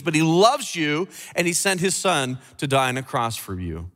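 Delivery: usual speaking voice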